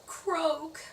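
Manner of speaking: high-pitched cartoon frog croak. A narrator's reading. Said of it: "She sounded like a frog".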